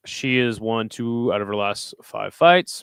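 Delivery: usual speaking voice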